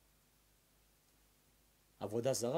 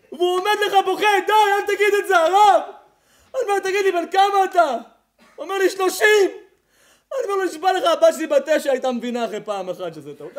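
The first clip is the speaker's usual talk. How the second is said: higher, louder mocking rant; fiery preaching with laughter; 10 semitones higher, 15 dB louder than usual. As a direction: tear into the lovesick young man. Preaching at full force.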